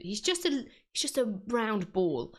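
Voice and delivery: ordinary talk, nothing marked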